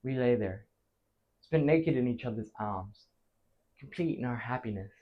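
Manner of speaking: low, soft, contented telling; tender reminiscence. Acted. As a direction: let it settle softly after the lovemaking. Recalling lovers at rest.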